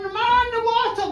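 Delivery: high cry down to the water; shouting alarm. Retold or imitated. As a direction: yell it from the bank. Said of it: "They're shouting down".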